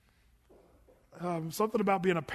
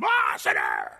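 much lower, loud hammed-up condemning shout; mock angry God; 7 dB louder, slower than usual. Pastor mocks an imagined wrathful God scolding a praying sinner, loud mock shout.

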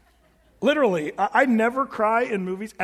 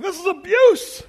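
high, loud, drawn-out protest; mock outrage; 10 semitones higher, 8 dB louder, slower than usual. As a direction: cry it out like a scandalized listener. Mocking a shocked listener's objection.

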